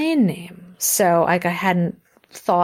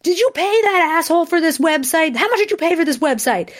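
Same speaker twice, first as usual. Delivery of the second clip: hammed-up outrage at the fiancé; mock outraged; 10 semitones higher than usual. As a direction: demand it like you've been robbed. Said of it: "I was just like".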